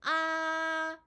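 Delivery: "아" held high, level and loud; first tone. Shown as a demonstration. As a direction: sustain "아" on one high note. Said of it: "다시요". ("아"를 높은 음으로 크고 평평하게 길게 유지)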